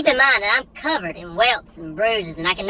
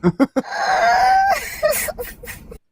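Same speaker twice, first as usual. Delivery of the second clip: mock crying.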